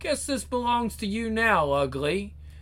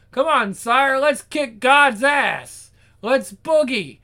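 eager rallying shout; 5 semitones higher, 7 dB louder, slower than usual. Acted. Henchman rallying the devil.

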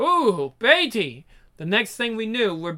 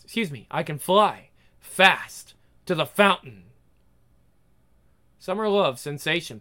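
ordinary talk, nothing marked

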